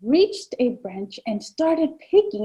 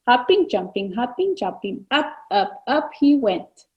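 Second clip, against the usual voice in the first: put-on reading to children. Reads picture book to children.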